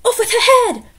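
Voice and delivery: loud high furious cry; Queen's shout. A narrator's reading. Queen turning on Alice with a loud high shout. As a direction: yell the order like a raging queen.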